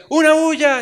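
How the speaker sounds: high, loud mock crowd shout; a loud party yell, hammed up; worldly shout. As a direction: bellow it like a rowdy crowd outside. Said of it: "Afuera decían".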